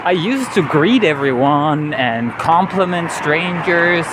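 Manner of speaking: ordinary talk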